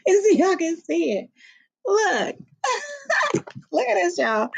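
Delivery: giggly and high, showing off; bubbling with delight over the outfit; excited. Real, not acted. Showing off shark onesie.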